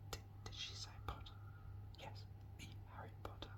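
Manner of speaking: fast, excited hushed whispering; a crowd of pupils whispering; whispers. A narrator's reading. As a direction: gossip under your breath across the hall. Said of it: "whispers broke out".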